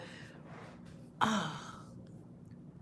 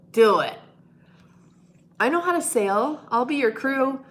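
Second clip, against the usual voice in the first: ordinary talk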